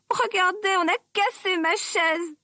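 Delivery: a tiny bear upset over his chair; baby bear's tiny crying voice. A narrator's reading. Baby bear finds his broken chair, tiny high voice. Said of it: "dit bébé ours en pleurant avec sa toute petite voix".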